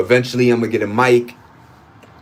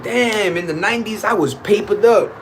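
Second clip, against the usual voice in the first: lightly voiced bragging, higher; boastful old-timer; 8 semitones higher than usual. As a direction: brag about the old days. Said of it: "you be like".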